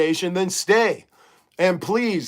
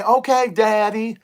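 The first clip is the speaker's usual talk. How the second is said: imitated Texas girl's southern twang, pitched up; higher-voiced mock southern belle twang; 5 semitones higher than usual. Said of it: "in her southern twang".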